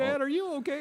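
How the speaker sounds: put-on high, loud anxious kid voice; a child asking his dad in alarm; worried kids. Kids ask their crying dad in the theater, high and worried. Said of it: "My kids are like".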